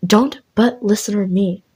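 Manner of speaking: ordinary delivery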